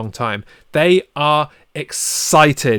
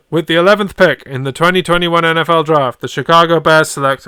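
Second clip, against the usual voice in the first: loud, higher-pitched TV announcer voice; draft announcer; 4 semitones higher, 6 dB louder than usual. Acted. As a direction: proclaim the pick like a draft announcer.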